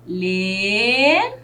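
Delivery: "Leão" split into two drawn-out syllables with a long gap; teacher slowly separating the syllables of "Leão". Shown as a demonstration. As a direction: stretch "Le", pause, then "ão". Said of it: "Quantas sílabas eu tenho nessa palavra".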